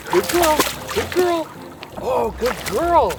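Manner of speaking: high soothing praise; praising a dog. Real, not acted.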